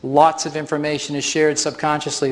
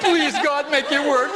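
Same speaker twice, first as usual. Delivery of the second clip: laughing mock prayer, pitched way up; laughing plea; 12 semitones higher, slower than usual. Presenter jokingly begging God for the test to work, laughing. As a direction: plead with God through a laugh.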